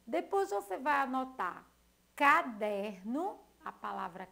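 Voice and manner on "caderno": caderno said slowly, ca-der-no, each syllable opened wide. Shown as a demonstration. Teacher dictating to pupils, slowly sounding out caderno. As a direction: spell the word out with your mouth. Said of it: "dividir ela nos pedacinhos de acordo como você abre a boca".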